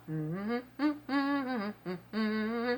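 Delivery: soft hummed mm-hmms to block out the noise; humming with plugged ears. Retold or imitated. Humming to block out the retching lady. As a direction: hum to drown out the retching. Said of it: "and I started going".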